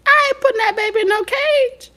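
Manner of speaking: very high incredulous squeal; squeaky outrage. Real, not acted. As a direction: squeak it out in disbelief. High retort to mother.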